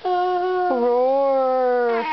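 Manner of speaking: drawn-out mock lion roar. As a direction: roar like a big storybook lion. Mom reading animal book to toddler.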